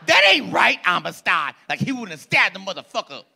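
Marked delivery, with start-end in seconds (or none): 0.1-1.6 high, loud yell at the screen; mock moviegoer yell. Imitating a rowdy moviegoer yelling at the screen, loud. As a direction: shout at the movie like a rowdy fan.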